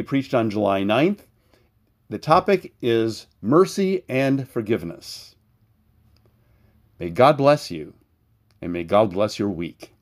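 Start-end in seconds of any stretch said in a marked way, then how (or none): none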